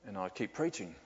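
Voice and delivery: ordinary talk, nothing marked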